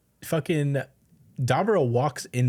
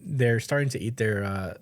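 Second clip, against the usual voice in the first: ordinary talk, nothing marked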